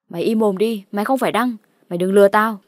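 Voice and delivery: loud, accusing outburst; angry rebuke. Acted. Narrator telling the false Đăng to shut up, angry and loud.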